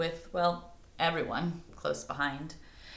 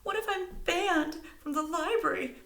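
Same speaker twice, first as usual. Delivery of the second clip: high, wailing worry; a hint of a sob; sobbing; 13 semitones higher than usual. A narrator's reading. Stella sobbing at the mother, mild wail. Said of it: "sobbed Stella".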